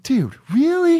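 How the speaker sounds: high, whiny "really, dude"; imitating a preachy nineteen-year-old; mock indignant kid. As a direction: mimic a snotty offended student. Mocking college kid's retort.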